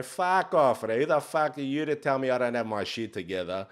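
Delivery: loud, high shouted outburst at the screen; imitating a viewer screaming back at the phone; angry yell. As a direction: bark it like an offended viewer. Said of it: "you're screaming at the T V".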